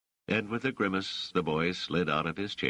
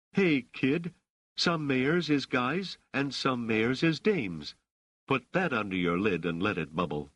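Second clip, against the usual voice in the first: Rochelle imitating Raich; 8 semitones higher than usual. A narrator's reading. Rochelle answers the boy in kind, imitating his tone. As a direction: playfully copy the boy's slangy tone. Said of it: "in a perfect imitation of his tone of voice".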